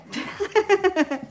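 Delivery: high, rippling giggle; real laugh. Real, not acted. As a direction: laugh off your own confession. Laughs after saying she ran away.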